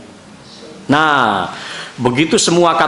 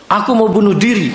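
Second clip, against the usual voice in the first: son's desperate words; 8 semitones higher than usual. Retold or imitated. Father retelling his son's shocking words, raised mock child cry. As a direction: give the boy's threat a raised, strained pitch.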